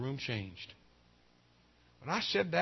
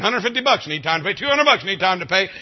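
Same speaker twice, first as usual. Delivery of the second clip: judge's fines on repeat; 3 semitones higher, 9 dB louder than usual. Retold or imitated. Judge's fines echoing all day.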